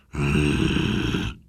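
very low lion growl; a deep grumbling hum; knurrender Löwe. A narrator's reading. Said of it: "knurrt der zottige Löwe". (tiefes Löwenknurren)

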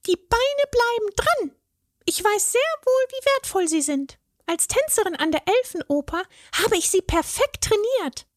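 very high fairy voice, prim and proud; a ballet fairy defending her legs; proud fairy dancer. A narrator's reading. Fairy tells the troll her legs stay on, high and proud.

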